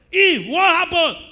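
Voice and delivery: loud, high demand aimed at Eve; God calling Eve. Retold or imitated. Preacher voicing God questioning Eve, loud and high. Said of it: "reminding me about the snake".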